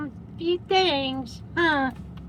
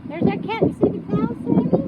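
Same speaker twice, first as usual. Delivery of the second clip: lifted, bright-toned pet talk, much higher; 9 semitones higher, 5 dB louder, faster than usual. Real, not acted. To Sammy the dog, pointing out the cows, high pet voice.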